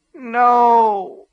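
high, loud, anguished moan of no; anguished denial. A narrator's reading. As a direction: wail it in grief. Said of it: "Cora moaned".

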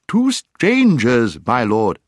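high, slow, drawn-out hoot; hooting owl announcement. A narrator's reading. Owl announcing strangers to the dwarf, hooting. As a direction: announce it slowly in an owl's high voice.

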